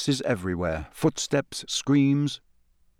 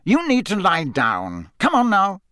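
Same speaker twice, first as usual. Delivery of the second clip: rough insistence; 8 semitones higher than usual. Acted. Moody insists.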